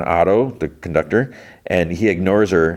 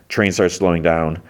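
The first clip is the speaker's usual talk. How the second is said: ordinary talk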